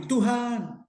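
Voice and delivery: high, drawn-out cry to God; imitated tearful plea. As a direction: call out plaintively, imitating someone crying. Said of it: "tiba-tiba dia nangis".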